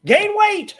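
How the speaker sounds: loud high heckle; a cheeky heckler's yell. Retold or imitated. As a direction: shout it from the crowd. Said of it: "a guy yelled out".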